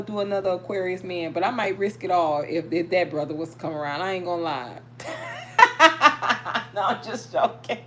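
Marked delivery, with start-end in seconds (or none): 4.8-6.7 high burst of laughter at her own joke; cackling at herself; loud laugh. Real, not acted. 6.7-7.8 laughing it off; giggling take-back. Real, not acted. Laughing off her joke.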